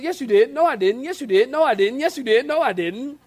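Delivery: high, loud back-and-forth; playful bickering. Acted. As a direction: rattle it off like a squabble. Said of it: "She goes".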